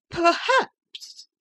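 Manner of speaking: high, indignant lady's voice; bristling, a lady caught out; offended lady. Acted.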